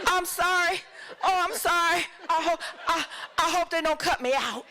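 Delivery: high, giggly, breathless sorry; giddy apology. Retold or imitated. Actress apologising to Fellini's crew, high and giggly.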